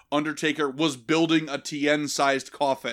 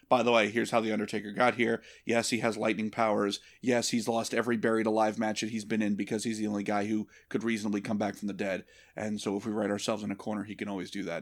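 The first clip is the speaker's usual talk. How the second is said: fast, low, softer rattling-off; mock explainer recital; 6 semitones lower, 3 dB quieter, faster than usual. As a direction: race through it like dull exposition. Mock lecture explaining the lore.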